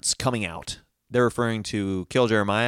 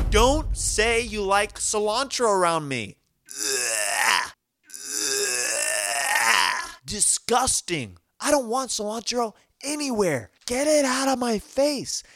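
disgusted rant; 10 semitones higher than usual. Real, not acted.

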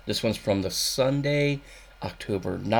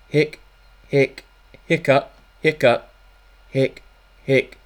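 loud comic hiccups, higher; hammed-up hiccups; 5 semitones higher, 8 dB louder than usual. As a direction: hiccup over and over like a cartoon. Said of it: "here's Stuffy Smith".

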